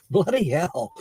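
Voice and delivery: put-on a laughing stab at a British accent, lightly done; mock British accent. Joking about a friend's brogue, mock British accent. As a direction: toss off a British swear in a playful British accent. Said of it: "that British accent?".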